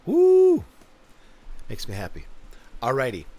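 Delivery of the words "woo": high, loud hoot; monkey-like woo. Retold or imitated. Proving it's in the DNA, high monkey whoop. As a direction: let out an excited primate whoop. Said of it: "Monkeys, woo!".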